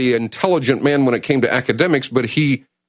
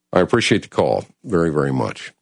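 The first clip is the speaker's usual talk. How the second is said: emotional; 6 semitones lower than usual. Real, not acted. Host thanking a caller, choked up. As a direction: voice dropping low and choked. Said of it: "I don't mean to get so emotional about this".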